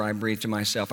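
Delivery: ordinary delivery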